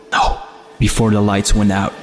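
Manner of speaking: plain reading voice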